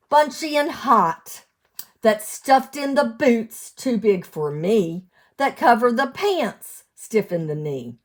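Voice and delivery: sing-song storybook read; lilting picture-book reading; reading to children. Acted. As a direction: read the rhyme out to little ones. Cumulative rhyme read aloud.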